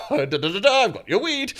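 loud sing-song call through the door; singsong announce. Retold or imitated. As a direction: chant it loud at the door like a delivery. Knocking, announcing weed delivery.

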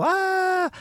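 high imitated fan shriek; fans screaming. Imitating the girls screaming at the concert, high shriek. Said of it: "folk havde allerede".

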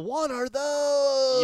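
high, slow, drawn-out "What are those?"; mock meme shout at ugly shoes. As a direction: stretch it out in disbelief, loud. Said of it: "I did the whole".

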